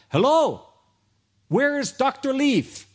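high, loud, urgent caller; frantic phone call. Retold or imitated. Imam calling the coordinator, loud high frantic voice. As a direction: shout it down the phone like a desperate father. Imam calls about his son.

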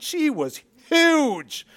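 high, slow, drawn-out exaggeration; drawn-out huge. Preacher to congregation, slow high exaggerated emphasis. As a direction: stretch it out to show how big she was. Stretching out how huge she was.

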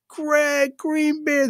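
high, slow, drawn-out call of a name; lady calling out. Retold or imitated. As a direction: stretch the name out high like spotting a friend. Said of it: "this lady goes".

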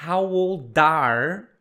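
put-on "old are" slowly joined as "ol-dar"; linked words. Shown as a demonstration. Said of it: "as palavras se juntam aqui". ("old are" junto, como "oul-dar")

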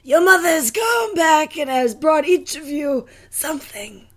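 hoarse wolf voice. Acted. Reading the fairy tale to a friend, as a hoarse wolf. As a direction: squeeze it out high like a wolf faking mom. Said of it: "in my best hoarse wolf voice".

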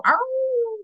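high howl like a wolf. Retold or imitated. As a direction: howl at the moon with your dog. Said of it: "We'd go".

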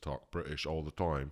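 low, casual attempt at British; half-hearted fake British vowels; bad British accent. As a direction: slip into a poor British accent. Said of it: "That was terrible".

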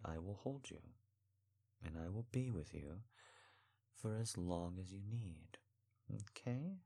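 soft, gentle repeated promise; hushed, steady comfort; tender reassurance. Acted. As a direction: reassure them quietly and sincerely.

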